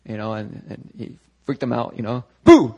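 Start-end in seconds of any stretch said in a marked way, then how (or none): 2.4-2.7 sudden loud, high boo; playful scare. Acted. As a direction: jump out and startle them. Imitates Jesus startling the disciples.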